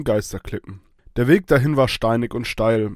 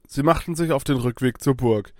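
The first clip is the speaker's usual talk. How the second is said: ordinary delivery, nothing marked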